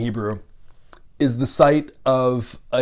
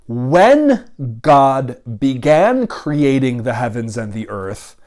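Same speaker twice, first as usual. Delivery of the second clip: deliberate reading; slower than usual. Shown as a demonstration. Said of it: "So we might read, rather than".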